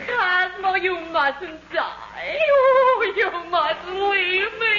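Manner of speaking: slow, sobbing plea; crying. Acted. Wife sobs over husband she thinks is dying. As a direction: beg through tears not to die.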